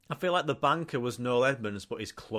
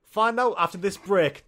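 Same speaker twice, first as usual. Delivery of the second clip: higher, louder host sign-off before the break; mock host announcement; 8 semitones higher, 4 dB louder than usual. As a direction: announce the ad break with big showbiz flair. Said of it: "what Noel would do".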